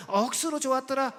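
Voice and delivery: punchy Gyeongsang-style cheer; Gyeongsang dialect. Shown as a demonstration. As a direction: put on a Busan, Gyeongsang accent. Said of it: "경상도 버전 계속 갑니다".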